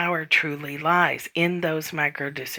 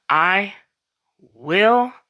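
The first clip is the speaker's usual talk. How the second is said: I and will each stressed, rising and louder; firm declaration; 4 semitones higher, 5 dB louder than usual. Acted. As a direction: plant each word like a staff. Declaring with non-negotiable energy.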